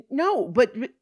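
high, loud, stammering objection; flustered protest. Acted. Woman asked if she is alone, flustered protest. Flustered under police questioning.

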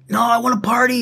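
high-pitched mock drunk protest; drunk insistence. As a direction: imitate a drunk guy refusing to leave. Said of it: "He's, like, fucked up".

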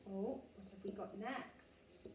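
ordinary delivery

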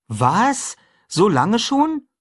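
high, loud, drawn-out surprise; boy amazed how long his friend has hidden; astonished boy. A narrator's reading. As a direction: stretch So lange schon in disbelief. Huck surprised.

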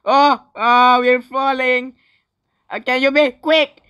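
high, loud urgent cry; panicked. Real, not acted. Player falling and urging haste, panicked. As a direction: call out in alarm, hurrying someone.

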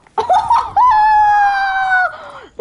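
high, loud, sustained cackling whoop; long gleeful whoop. Real, not acted. Gamer delighted with the game's loot, gleeful whoop.

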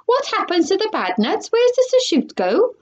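high, frantic questioning; shrieked. A narrator's reading. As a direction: demand answers in rising panic. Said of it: "shrieked Missus Salt".

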